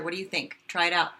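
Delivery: ordinary talk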